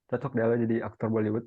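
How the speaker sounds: normal talking voice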